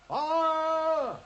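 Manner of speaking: loud high alarm cry of fire; shout of fire. Acted. Holmes raises the false alarm, shouting loud. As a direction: bellow it to fake a fire alarm. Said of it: "join me in a shout of fire".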